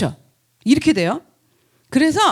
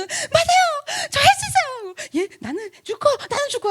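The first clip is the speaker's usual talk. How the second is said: mock zealous believer; 13 semitones higher than usual. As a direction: parody an over-eager believer's pledge. (열성 신도 과장 흉내)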